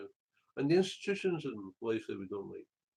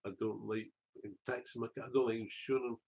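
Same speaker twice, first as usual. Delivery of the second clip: normal talking voice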